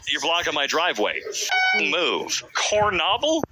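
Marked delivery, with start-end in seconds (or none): 2.5-3.4 "Cornobble" with the middle as NOB, rising like a question; trial pronunciation. Shown as a demonstration. Reader testing an unknown word, "Cornobble" as cor-NOB-ul, questioning.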